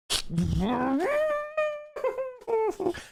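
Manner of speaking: laugh. Real, not acted. Laugh after the insult tirade.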